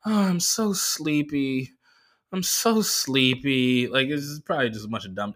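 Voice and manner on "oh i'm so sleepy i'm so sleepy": slow, drawn-out sleepy purr; mock sleepy. Comic acting the woman in a slow sleepy voice. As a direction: stretch it out like she's dozing off. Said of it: "she's like".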